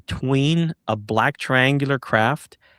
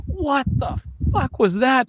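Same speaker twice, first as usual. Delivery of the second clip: much higher, shocked outburst; freaked out; 13 semitones higher than usual. Retold or imitated. Cop reacts to the craft overhead, with a high freaked-out yelp. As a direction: yelp it like you can't believe your eyes. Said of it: "freaked out".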